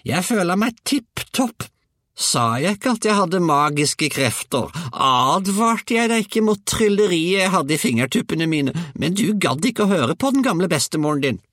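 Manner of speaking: put-on very high, loud old-woman tirade; ranting grandmother. A narrator's reading. Grandmother boasts and scolds her grandson, high loud rant. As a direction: crow and scold at full height.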